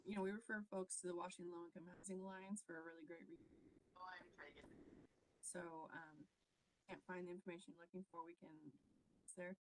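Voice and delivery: distant, faint voice off mic; speaking away from the microphone; far from mic. Real, not acted. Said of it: "I'm kinda having a hard time hearing [name]".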